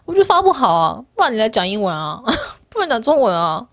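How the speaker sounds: ordinary talk, nothing marked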